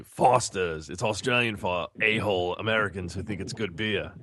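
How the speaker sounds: lightly voiced beer commercial announcer; mock ad voice. Cohost parodying the Foster's beer commercial, mock announcer. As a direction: parody the ad tagline. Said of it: "remake of that commercial and say".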